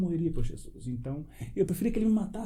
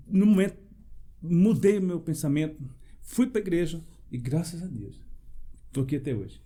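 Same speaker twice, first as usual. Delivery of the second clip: ordinary talk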